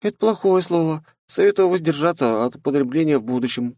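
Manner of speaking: a prim warning; Mai voice. Acted. Mai scolds her master's bad language. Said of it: "предупредила Май".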